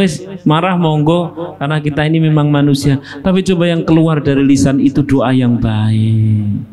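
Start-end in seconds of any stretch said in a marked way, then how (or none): none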